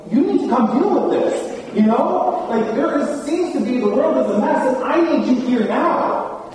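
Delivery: pleading high and loud to God, urgent; urgent plea. Pretend. Preacher voicing her own urgent prayer, high and loud. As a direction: call on Jesus to come deal with the mess. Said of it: "I'm like".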